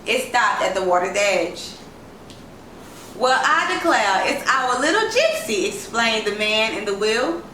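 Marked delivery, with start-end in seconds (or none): 3.1-5.7 a lightly raised, hearty man's voice; voiced jovial driver. A narrator's reading. Driver hails the little girl from his car, lightly voiced and amused. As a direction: greet the girl with amused surprise. Man in car greets Isis.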